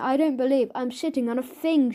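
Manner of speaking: usual speaking voice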